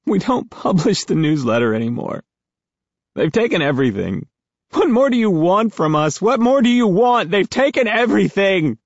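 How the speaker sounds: high, tearful, breaking voice; crying. A narrator's reading. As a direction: choke it out through tears. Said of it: "it sounds like the guy's crying".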